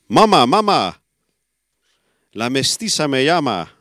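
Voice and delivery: imitated calling out. Deaf-mute daughter suddenly calls to her mother, imitated call. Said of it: "riep ineens".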